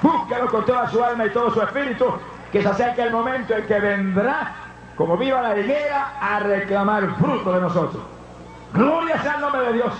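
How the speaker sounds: high, fervent pulpit cry; high-pitched revival preaching; preaching at full force. Acted.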